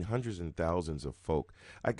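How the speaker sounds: normal talking voice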